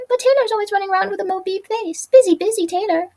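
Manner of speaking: high, eager little voice; squeaky Spoolie. Acted. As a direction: plead kindly in a high squeak.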